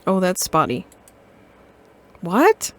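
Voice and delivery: ordinary talk, nothing marked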